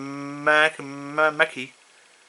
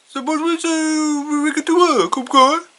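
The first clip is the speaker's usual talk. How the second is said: Mister Mackey voice; 13 semitones higher, 6 dB louder than usual. Retold or imitated. Mister Mackey praising the boys' idea, in a much higher put-on voice. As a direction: play the school counselor musing, pitched way up. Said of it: "And Mister Mac is like".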